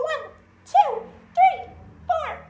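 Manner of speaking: high, tense count as if clenching; counting through a full-body clench; strained counting. Shown as a demonstration. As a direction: squeeze the numbers out, straining. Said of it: "Clench every single muscle".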